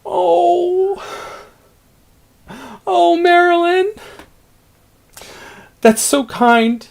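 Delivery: high, slow, choked-up thanks; tearful big emotional reaction. Shown as a demonstration. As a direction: overcome with grateful tears. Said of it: "just have that big emotional feeling".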